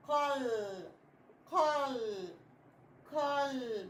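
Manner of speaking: قل as one syllable with a held final l; qal, ending on a long vowelless l; blended closed syllable. Shown as a demonstration. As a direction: join قل up and hold the lam. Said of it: "لازم ننطقهم مع بعض".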